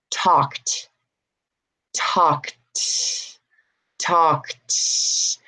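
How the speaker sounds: "talked" as one syllable, tawkt; final T sound. Shown as a demonstration. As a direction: end "talked" on a crisp released T, no E. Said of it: "It's not a D".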